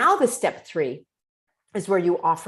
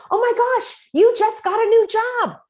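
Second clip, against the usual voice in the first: very high, loud "oh my gosh" for happy news; mock thrilled, pitched way up; excited reflecting; 6 dB louder than usual. Shown as a demonstration. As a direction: gush back their good news. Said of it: "And you say".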